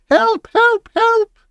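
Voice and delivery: very high mocking shriek; shrieking teachers mocked; mock teacher panic. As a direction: mimic terrified teachers. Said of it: "holding up skirts and yelling".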